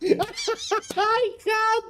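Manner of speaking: high-pitched laughing through the words; laughing helplessly. Real, not acted. Caster cracking up at every failed skill check, laughing through it. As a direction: squeak the words out mid-giggle. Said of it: "I'm dying every time they fail".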